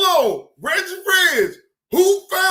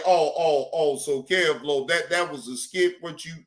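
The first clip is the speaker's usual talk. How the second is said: lower, teasing retort; mocking own reply; 11 semitones lower than usual. Answering Cam Lo mockingly. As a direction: tease him back low and dry. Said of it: "I say".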